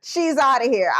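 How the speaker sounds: scolding mom. Retold or imitated. Mother mocking her child over the missing cat, laughing scold. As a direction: tease her through laughter for not noticing. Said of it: "my mom was like".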